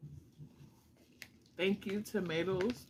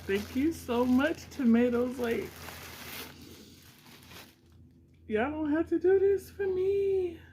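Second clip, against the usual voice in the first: overjoyed thanks; 9 semitones higher, 3 dB louder than usual. Real, not acted. Overcome thanking fans.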